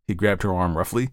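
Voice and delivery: ordinary delivery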